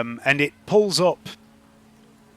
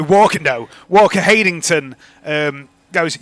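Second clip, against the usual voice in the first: ordinary talk, nothing marked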